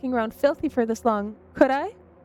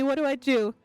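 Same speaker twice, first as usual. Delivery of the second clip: ordinary talk, nothing marked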